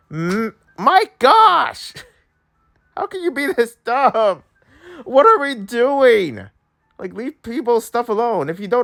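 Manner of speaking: high-pitched exasperated scolding; angry rant. Real, not acted. Streamer telling off a girl who messed with someone's stuff, exasperated rant. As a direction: scold them, drawing out the key words. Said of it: "This is how angry I am".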